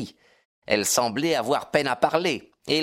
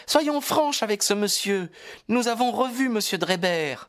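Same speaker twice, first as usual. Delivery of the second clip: put-on higher-pitched, confident young woman; clear and assured; 9 semitones higher than usual. A narrator's reading. Daughter confessing before the detective, clear and assured. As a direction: state it clearly, high and sure. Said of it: "d'une voix claire et assurée".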